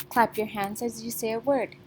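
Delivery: ordinary talk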